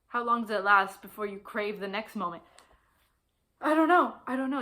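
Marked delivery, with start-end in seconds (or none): 3.6-4.6 loud, high cry of not knowing; exasperated outburst. Real, not acted. Book lover stumped by the ending's question, exasperated. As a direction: throw it out loud and high, then ease off. Gives up on book's open question.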